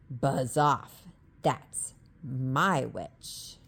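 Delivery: low, loud, slow beast voice; growling beast. A narrator's reading. Beast warning the dragon away from the witch. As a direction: growl it to scare him off.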